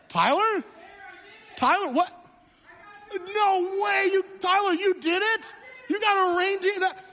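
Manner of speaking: hammed-up shout of surprise at a reindeer; amazed disbelief. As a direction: erupt in staged disbelief. Staged surprise over a phone call.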